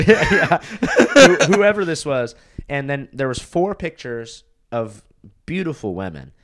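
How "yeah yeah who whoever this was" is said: loud, high, through laughter; real laughter breaking into the words; laughing. Real, not acted. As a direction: crack up while carrying on talking. Laughing at friend's name joke.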